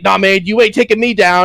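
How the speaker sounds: lightly hammed stubborn villager; a hint of a tough-guy voice; defiant NPC. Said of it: "and he's like".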